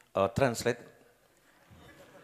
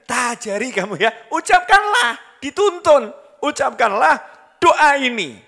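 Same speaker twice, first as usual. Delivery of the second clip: much higher and louder, soaring preacher's cry; high preaching cry; 12 semitones higher, 11 dB louder than usual. Acted. Preacher voicing the Prophet teaching his wife, high and loud. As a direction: lift it up and proclaim each word.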